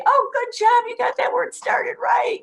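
put-on high, bright praise for a child; over-the-top teacher praise; enthusiastic praise. Shown as a demonstration. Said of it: "always point out what they did right".

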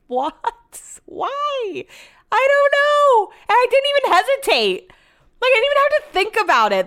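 high, squeaky laughing at herself; laughing disbelief. Real, not acted. Vlogger laughing at her own instant lie, high and incredulous. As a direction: laugh at how fast you lied. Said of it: "The lie just rolled right off".

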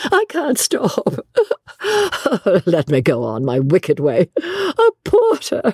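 high, drawn-out words through laughter; gleeful, squealing hysterics; helpless laughter. A narrator's reading. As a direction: laugh so much you can barely speak. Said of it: "She could not control her mirth".

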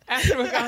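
Ashwagandha said high and bright, relishing each syllable; playful word savoring. Shown as a demonstration. To her friend, playfully savoring a fun word. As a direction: toss out "Ashwagandha" like a treat. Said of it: "I do".

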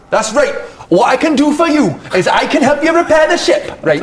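high-pitched, eager Geordie bosun; attempted Geordie accent. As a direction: offer help in a wobbly put-on Geordie. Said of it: "he's trying to be a Geordie".

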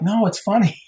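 high-pitched defensive protest; insistent protest. Retold or imitated. Pranksters defending themselves to the angry actress, insistent. Pleading after prank angered her.

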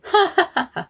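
a sudden high burst of laughter. Real, not acted. Laughing at her own naive plan, high burst of laughter. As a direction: laugh at how wrong she was.

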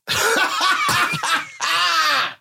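long, high-pitched burst of laughter; high cackling laugh. Real, not acted. Guest laughing at their own office joke, high cackle.

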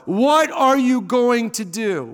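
normal talking voice

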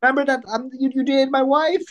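very high, squeaky joke voice; mock chummy reminder. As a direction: play the awkward old pal, way up high. Imagined call to a wrestler.

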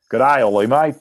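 mock-hearty Australian hello; Australian accent. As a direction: imitate an Australian caller. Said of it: "an Australian accent on the phone".

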